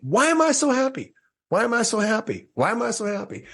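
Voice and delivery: very high, sing-out mimicking of her; mock emphatic cheer. Woman demonstrating her happiness question, mock emphatic cheer. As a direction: say it way up high like you really mean it. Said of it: "speak it out loud like you really mean it".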